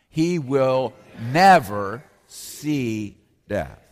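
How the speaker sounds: very slow, each word weighed and leaned on; slow emphatic proclamation. Shown as a demonstration. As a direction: let every word land heavily, slowly. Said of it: "One more time".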